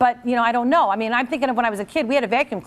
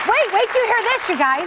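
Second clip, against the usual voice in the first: much higher, eager wait-for-it; excited buildup; 10 semitones higher than usual. Real, not acted. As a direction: tease the big reveal. Building up the outrage.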